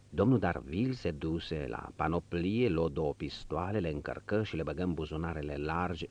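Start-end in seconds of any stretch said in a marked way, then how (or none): none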